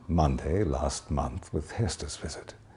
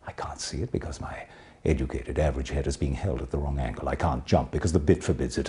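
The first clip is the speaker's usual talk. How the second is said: usual speaking voice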